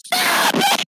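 high-pitched yelling; squabbling shouts. Real, not acted. Kids squabble over whose dream the podcast is, yelling. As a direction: yell it high, drawing it out.

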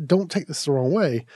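normal talking voice

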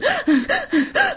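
sharp high gasp of fright; sissy gasp. Retold or imitated. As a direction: gasp like a scared girlfriend. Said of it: "she goes".

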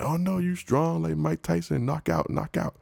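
high, eager street seller voice; mock hawker. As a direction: play the pushy seller flattering him. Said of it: "Then they be like".

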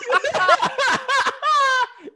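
high, loud burst of laughter; laughing at his friend's slip, shrieking. Real, not acted.

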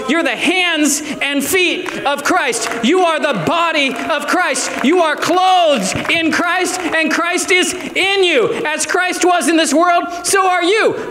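a preacher proclaiming who the church is; full-force preaching. Acted. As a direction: build the declarations to a roaring climax.

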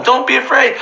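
ordinary talk, nothing marked

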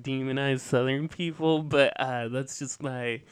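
usual speaking voice

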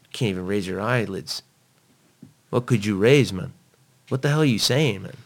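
exasperated retold scolding; indignant challenge. Retells scolding the girlfriend.

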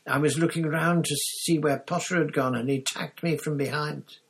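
high, loud indignant character voice; aggrieved victim. A narrator's reading. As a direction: protest the attack, pitched well up. Injured Krum accuses his attacker.

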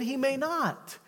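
ordinary talk

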